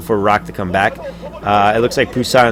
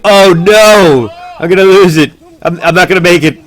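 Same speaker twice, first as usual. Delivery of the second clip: high, loud panicking voice; panicked; 8 semitones higher, 8 dB louder than usual. Retold or imitated. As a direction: cry out that it's all lost. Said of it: "He's like".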